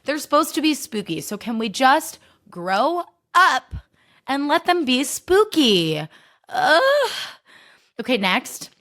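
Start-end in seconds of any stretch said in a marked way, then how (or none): 1.2-6.1 exasperated drawn-out plea. Real, not acted. 6.5-7.1 a high, disgusted "ugh" groan; high exasperated ugh. Real, not acted. Ranting podcaster groans in disgust at the cute rats, exasperated.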